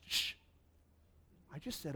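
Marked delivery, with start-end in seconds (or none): 0.1-0.3 long hushing "shh"; soft, sharp shush. Acted. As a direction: hush someone mid-sentence. Acted hushing of the speaker.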